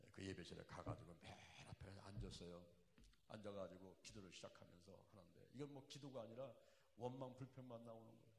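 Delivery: ordinary delivery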